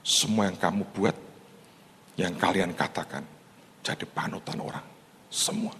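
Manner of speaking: hushed, secretive murmur, mostly soft with a breathy stretch; retold whisper. Politician whispering a secret to the pastor, hushed. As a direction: lean in and confide it quietly. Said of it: "Terus dia bisikin saya".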